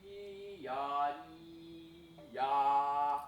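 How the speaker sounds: vocal imitation of a string tone growing loud; open string sound. Shown as a demonstration. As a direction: mimic an uncontrolled open string. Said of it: "that open string goes".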